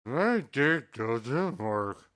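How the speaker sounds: slow, flat robotic monotone. Pretend. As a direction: drag each word out like a machine. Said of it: "I don't know how to sound like a robot".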